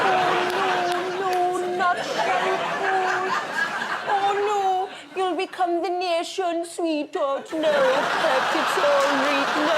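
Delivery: high, drawn-out attempt at Geordie; badly faked Geordie wail, loud and high; bad Geordie accent. Pleading as the nation's sweetheart in a bad Geordie accent. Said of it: "I can't do a Geordie".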